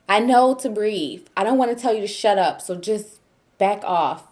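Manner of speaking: retold irritation, loud and emphatic; exasperated retort. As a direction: act out fending off a nagging husband. Retelling snapping at husband in labor.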